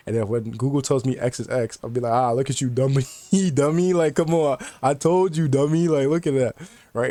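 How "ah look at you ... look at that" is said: loud, high-pitched teasing gloat with laughs; joking taunt. Retold or imitated. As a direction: crow "told you so" playfully, laughing through it. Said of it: "In a joking way".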